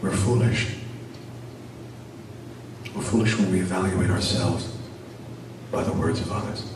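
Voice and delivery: soft, low, hushed wisdom; gentle father. Acted. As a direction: answer the crying son tenderly. Father's gentle closing moral.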